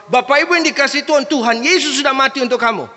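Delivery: high, loud, fast proclamation; fiery preacher. Acted. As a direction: fire it at the congregation. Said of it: "jemaat ini tipikalnya pendeta harus". (khotbah lantang, tinggi dan berapi-api)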